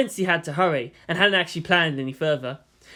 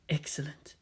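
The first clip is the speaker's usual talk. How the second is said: much softer scheming whisper; 11 dB quieter than usual. Acted. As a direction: breathe it out pleased. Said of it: "she whispered".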